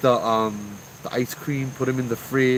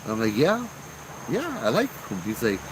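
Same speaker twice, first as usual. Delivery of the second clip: lightly voiced uncle, pitched up; uncle in conversation; 8 semitones higher than usual. Uncle asks young Joe about ghost stories, eager questioning. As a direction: lift the voice as the uncle asking.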